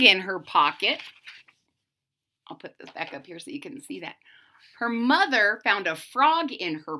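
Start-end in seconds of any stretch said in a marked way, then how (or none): 2.5-4.2 soft, fast, under the breath; quiet aside. Real, not acted. Reader softly aside while holding up the page. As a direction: mutter it quickly to yourself.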